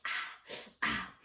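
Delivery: soft imitated moans of pain from the floor. Woman on the floor after falling, soft mock moans. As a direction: replay the hurt with quiet little moans. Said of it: "me on the floor and going".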